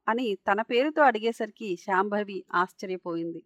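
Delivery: ordinary delivery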